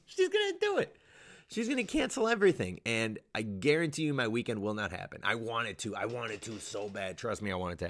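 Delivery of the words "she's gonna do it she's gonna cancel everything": very high, squeaky exclamation; squeaked comic outrage; comic alarm. Real, not acted. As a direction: warn in a laughing, high-pitched voice. Laughing, squeaky prediction of cancellations.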